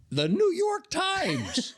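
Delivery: "New York Times" stretched out high and slow; comic emphasis on "New York Times"; emphatic disbelief. Real, not acted. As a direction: hit "New York Times" high, drawn out, falling at the end. Incredulous comic emphasis on the source.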